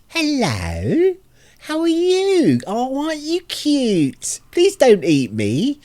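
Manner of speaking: very high, slow cooing; drawn-out, high-pitched pet voice; talking to a cat. Shown as a demonstration. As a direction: coo sweetly as if to a cat. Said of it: "like when I see a cat".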